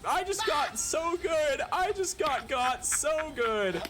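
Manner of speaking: shrieking high in sheer surprise; a real startled scream of excitement; excited shriek. Real, not acted. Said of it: "just hard programmed to react".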